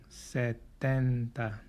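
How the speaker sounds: very slow cuarenta, every syllable drawn out; slow model word. Shown as a demonstration. Teacher drills cuarenta for students, very slowly. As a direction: stretch cuarenta out for learners to copy. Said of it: "Say it with me".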